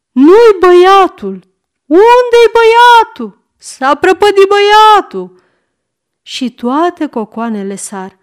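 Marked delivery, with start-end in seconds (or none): 0.1-5.4 very high, loud alarm; panicked cries. A narrator's reading. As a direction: wail that the child is lost. Ladies panic over missing boy. (țipete de panică)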